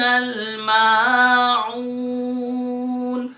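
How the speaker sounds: sung Quran recitation, slow and drawn out; hadr recitation. Shown as a demonstration. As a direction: recite the whole sura melodically. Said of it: "اب میں حدر میں آپ کے سامنے پڑھوں گا". (حدر میں تلاوت)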